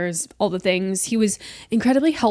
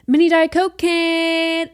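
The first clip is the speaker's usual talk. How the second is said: slow, high announcer voice; a showy, drawn-out reveal of the can; 9 semitones higher, 5 dB louder, slower than usual. Pretend. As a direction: present the drink like a game-show host. Announcing the beverage, sing-song.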